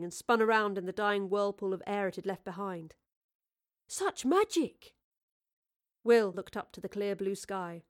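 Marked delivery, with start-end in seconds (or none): 3.9-5.0 high, slow, breathless marvelling; wonder-filled exclamation; awestruck. Acted. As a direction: draw it out in amazement. Thomas marvels at the vanishing machine.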